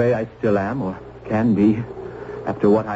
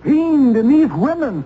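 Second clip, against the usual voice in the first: much higher, impassioned demand; heated challenge; 13 semitones higher than usual. Acted. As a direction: demand an answer, voice straining. Challenging the trial's justice.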